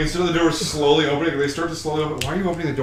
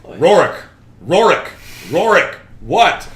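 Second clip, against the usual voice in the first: calling out loudly; 4 semitones higher, 5 dB louder, slower than usual. Acted. Blasting recorded name at him.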